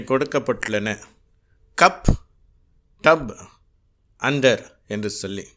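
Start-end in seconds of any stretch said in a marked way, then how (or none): 1.8-4.6 கப், டப், அண்டர் with a sharp short a for the u; sharp a sound demo. Shown as a demonstration. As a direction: snap each word with a short a. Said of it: "ரொம்ப ஷார்ப்பான sound". (யூ எழுத்தை கூர்மையான அ ஒலியாக உச்சரித்தல்)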